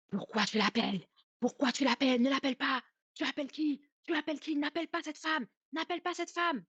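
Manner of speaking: high, strained imitation of a possessed voice; possessed man's voice. Possessed mother forbids cousin to call the aunt, in an acted demonic man's voice. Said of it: "C'était une voix très grave, une voix d'homme".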